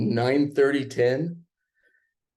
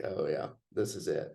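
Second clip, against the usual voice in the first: resigned inner voice; 6 semitones lower, 9 dB quieter than usual. Retold or imitated. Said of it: "I was like".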